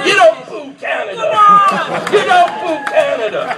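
high, indignant scolding; mock indignant. Real, not acted. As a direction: scold the crowd for booing.

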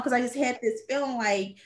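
ordinary delivery

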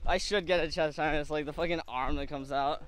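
ordinary delivery

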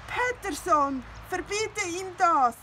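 high, loud whiny plea; whining. Acted. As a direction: beg like a wailing cat. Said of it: "jammerte Findus".

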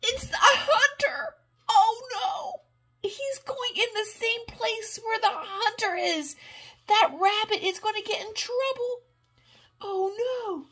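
high, loud mock alarm; alarmed excitement. Grandma reading to grandchild, hamming up alarm. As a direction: play up the danger for the child. Suspense at hunter in tent.

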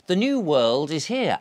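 usual speaking voice

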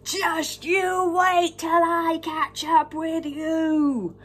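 loud, slow threatening giant bellow. Acted. The giant bellowing at Jack as he escapes, loud and slow. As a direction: bellow it slowly after the fleeing boy. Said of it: "The giant bellowed".

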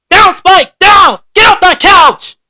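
high, loud commands to a dog; sharp angry owner commands; scolding shout. Acted. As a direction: yell at the dog to get down.

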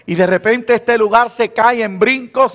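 ordinary delivery

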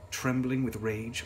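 ordinary delivery, nothing marked

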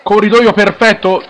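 high, loud goal call shouted breathlessly; breathless italian goal commentary. Real, not acted. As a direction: scream the goal, rising with excitement. Commentator calls a goal live.

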